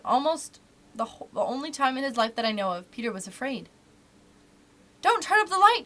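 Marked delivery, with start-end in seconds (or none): 5.0-5.8 high, loud, alarmed cry; frightened cry. A narrator's reading. Peter begs Wendy not to light the room, crying out afraid. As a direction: cry out in fear to stop her. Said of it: "he cried".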